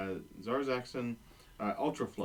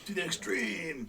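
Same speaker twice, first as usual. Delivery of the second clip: playful, higher-pitched exclamation; mock rallying cry; 5 semitones higher than usual. Player cheering the group's vote, mock rallying cry. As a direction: call it out like a catchphrase.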